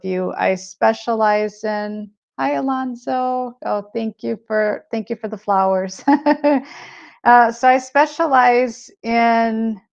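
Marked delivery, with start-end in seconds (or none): 6.1-6.6 short pleased chuckle; light laugh. Real, not acted. Streamer thanking a viewer for flowers, light laugh. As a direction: give a brief, higher-pitched laugh.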